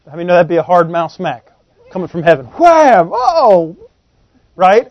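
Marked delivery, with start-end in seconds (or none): 2.4-3.1 loud, high "Wham!" like a slap from heaven; mock smack. 3.1-3.7 high, loud "Oh!" as if just hit; mock pained cry. Reaction to imagined smack.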